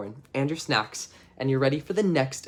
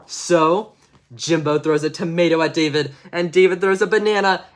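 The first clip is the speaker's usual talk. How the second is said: ordinary delivery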